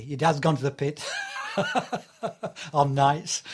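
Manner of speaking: loud, laughing through the news from home; laughing loud contrast with the posh newsletter; laughing mock announcement. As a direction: blurt it out between laughs. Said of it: "So the difference".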